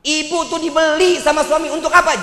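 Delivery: a preacher building his punchline on dowry; rising sermon shout. Real, not acted. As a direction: hammer the point home to the crowd. Preacher hammering a point.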